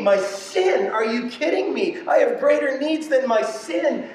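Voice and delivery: high-pitched, incredulous retort; indignant protest. Acted. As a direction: argue back in mock disbelief. Acted indignant retort to God.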